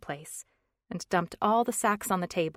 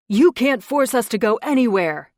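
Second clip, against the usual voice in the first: defiant shout; 5 semitones higher, 12 dB louder than usual. Acted. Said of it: "said a male voice".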